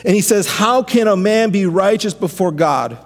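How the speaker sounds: fervent pastor preaching Job's cry; preaching at full force. Acted. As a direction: preach it out, rising to a climax. Builds sermon over music.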